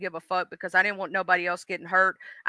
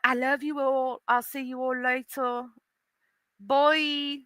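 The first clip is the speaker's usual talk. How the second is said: higher-pitched mock British farewell; fake British accent; 4 semitones higher, 5 dB quieter, slower than usual. Shown as a demonstration. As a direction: put on a hammy British goodbye. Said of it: "did a fake British accent".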